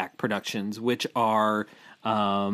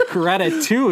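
laughing; 8 semitones higher, 7 dB louder than usual. Real, not acted. Joking repetition of the title.

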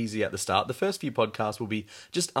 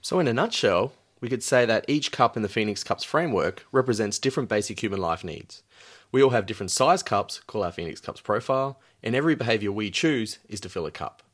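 ordinary talk, nothing marked

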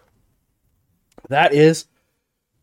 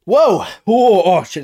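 shocked; 4 semitones higher, 4 dB louder, much slower than usual. Real, not acted. Hot sauce burning mouth.

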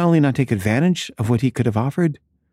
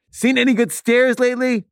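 mocking heckle; 13 semitones higher than usual. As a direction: taunt him like a campus heckler. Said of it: "yell at him".

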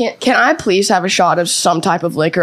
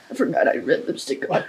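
whispered aside; 6 semitones lower, 8 dB quieter, faster than usual. Real, not acted. Muttering aside after the bite.

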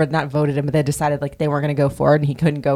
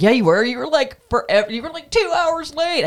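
rising higher, lightly mocking insistence; imitating Sandoval; 10 semitones higher than usual. Host imitating Sandoval insisting Nick was late, mock whine. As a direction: push the exaggeration up in pitch. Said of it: "And he's like".

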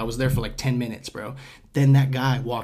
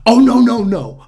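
higher, louder sing-song no; mocking dismissal; 9 semitones higher, 9 dB louder than usual. Customer dismissing the clerk's question, mocking and loud. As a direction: wave him off with exaggerated denial.

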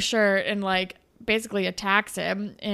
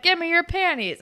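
higher, louder mock creep; creepy frat guy; 8 semitones higher, 5 dB louder than usual. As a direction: ham up the creepy demand. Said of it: "And then he's like".

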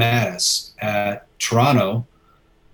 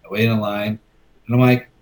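usual speaking voice

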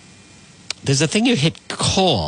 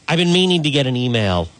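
ordinary talk

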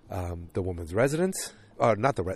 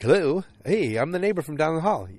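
neighbor voice; 7 semitones higher, 3 dB louder than usual. Retold or imitated.

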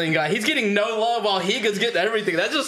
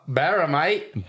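put-on Australian accent; 3 semitones lower, much slower than usual. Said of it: "I feel like Australians would say".

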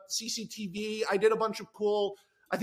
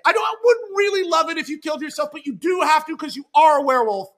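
rushed loud backpedal, higher; fast shout; 7 semitones higher, 8 dB louder than usual. Acted. Jack backpedalling loudly to David, fast shout. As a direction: blurt it out loud and quick.

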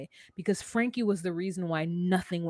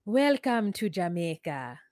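slow put-on Jamaican greeting; relaxed, slowed Jamaican accent; Jamaican patois accent; 3 semitones lower than usual. Shown as a demonstration. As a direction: draw it out with a Jamaican lilt. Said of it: "the way Jamaicans speak their patois".

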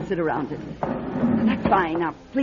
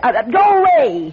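loud, higher, irritated refusal; shouting someone away; 9 semitones higher, 10 dB louder than usual. Acted. Madame Natalia yelling at a visitor to go away, loud irritated shout. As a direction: call through the door to make them leave.

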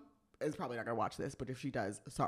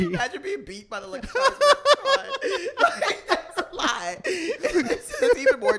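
high, loud, laughing through words; cracking up while talking; 14 semitones higher, 9 dB louder than usual. Real, not acted. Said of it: "laughing hard mid-sentence".

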